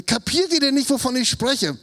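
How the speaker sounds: high, loud fed-up teacher; exasperated scolding. Acted. Jesus scolding his disciples for not understanding, exasperated. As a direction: throw it at them in disbelief, voice up.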